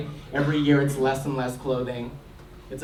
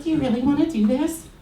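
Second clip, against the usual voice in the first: high mock voice; 11 semitones higher than usual. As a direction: lift into a high, unsure voice. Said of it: "She's like".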